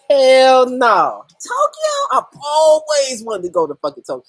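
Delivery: high, whiny cry of envy over Tokyo; mock-tearful envy; jealous excitement. Real, not acted. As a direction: wail it like you're dying to go. Reacts to viewer in Tokyo.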